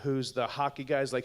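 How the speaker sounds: ordinary delivery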